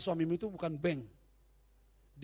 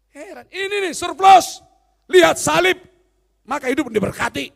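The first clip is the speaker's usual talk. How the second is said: full-force preaching; 6 semitones higher, 15 dB louder than usual. Acted. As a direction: hammer the blessing home.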